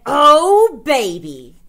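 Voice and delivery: gleeful burst, up then down. Real, not acted. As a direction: savor it like a prize. Dreaming of the store costume.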